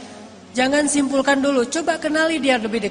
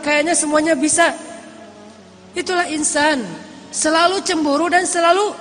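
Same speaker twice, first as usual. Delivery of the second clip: higher, emphatic preacher's voice; raised, insistent sermon delivery; impassioned preaching; 5 semitones higher, 4 dB louder than usual. Acted. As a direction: preach it out with rising conviction.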